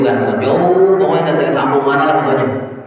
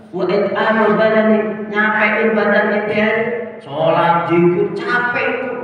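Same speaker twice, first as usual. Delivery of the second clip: mocking devil; 7 semitones higher than usual. Devil mocking worship as tiring.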